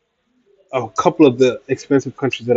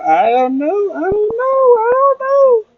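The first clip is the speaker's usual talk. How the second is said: each "I don't know" climbing much higher until it squeaks; rising squeak. Pretend. Vlogger teasing chat with climbing falsetto squeak. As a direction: let the voice ride up into a comic falsetto.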